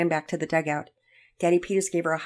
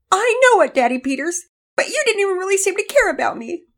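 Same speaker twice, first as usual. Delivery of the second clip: put-on high, loud, hurt child's wail; acted upset girl, much higher voice; sobbing protest; 14 semitones higher, 8 dB louder than usual. A narrator's reading. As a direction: accuse a parent through tears. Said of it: "Penelope was sobbing".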